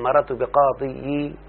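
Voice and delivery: بقاضي slowed, ending in a separate "yi"; heavy kasra ending. Shown as a demonstration. Teacher showing why the form is avoided, heavy kasra. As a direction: sound out an awkward extra "yi" on بقاضي. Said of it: "فالضمة والكسرة ثقيلان على اللسان".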